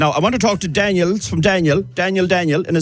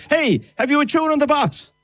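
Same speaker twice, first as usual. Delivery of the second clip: high-pitched call to a passer-by; 11 semitones higher than usual. Real, not acted. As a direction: hail someone going past, pitch jumping up. Calls out to a passer-by.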